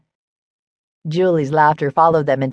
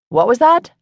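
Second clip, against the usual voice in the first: high, louder, eager query; pouncing question; 9 semitones higher, 5 dB louder than usual. A narrator's reading. Julie catching Faith's mumbled remark, pouncing on it. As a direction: pounce on the slip, voice leaping up. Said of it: "pouncing on Faith's statement".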